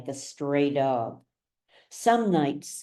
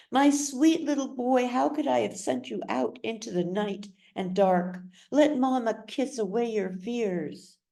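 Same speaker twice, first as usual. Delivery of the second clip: Alma soothing River; 6 semitones higher than usual. Acted. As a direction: soothe him while hiding the lie.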